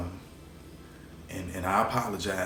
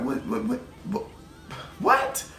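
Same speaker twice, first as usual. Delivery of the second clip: stacked whats climbing far higher to a squeaky peak; frantic disbelief; 13 semitones higher than usual. Retold or imitated. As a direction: disbelief spiralling up in panic. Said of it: "I'm like".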